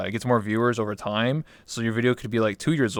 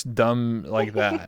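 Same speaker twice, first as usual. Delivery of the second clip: normal talking voice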